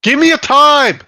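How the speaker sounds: yelling, high and loud; angry shout. Real, not acted. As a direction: bark the demand, fed up. Angry demand in gaming argument.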